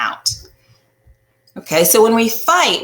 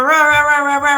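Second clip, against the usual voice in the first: building mock ranting noise, louder; mock angry rah rah; 9 semitones higher, 7 dB louder than usual. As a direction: rah rah as a rising grumble, pitch up. Imitating escalating argument.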